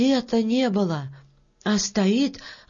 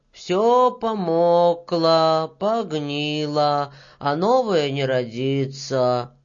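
slow, drawn-out lament; 3 semitones lower, slower than usual. Acted. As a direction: stretch the vowels, sinking lower to the end.

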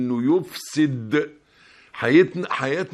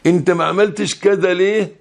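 louder, confronting question; sharp challenge; 5 semitones higher, 6 dB louder than usual. Shown as a demonstration. Preacher showing an accusing question, louder. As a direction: throw the question back hard. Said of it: "وقف مع نفسك بس كده".

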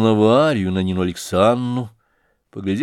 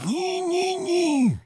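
tiny, very high, hushed squeal; frightened whispering; 3 dB quieter, much slower than usual. A narrator's reading. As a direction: plead in a soft high squeak of alarm. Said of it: "зашептал ему снова Лебедев с страшно испуганным видом". (испуганный тонкий шёпот)

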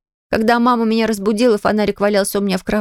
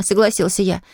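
plain reading voice

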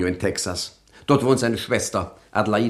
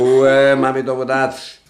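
loud, higher barked command; shouting her down; sharp shout; 4 semitones higher, 6 dB louder, slower than usual. Acted. Silencing the panicking landlady.

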